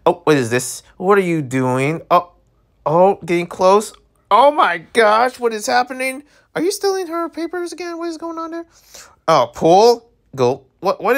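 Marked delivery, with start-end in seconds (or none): 4.3-8.7 high, quickening excited questions; excited disbelief. Real, not acted. Streamer watching him steal her papers, excited. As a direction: rattle them off, high and thrilled. Watching a scene unfold in game.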